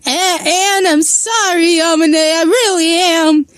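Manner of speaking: high, faltering tearful apology; holding back tears. A narrator's reading. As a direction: stammer it out as if choking back sobs. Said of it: "as if trying to hold something in".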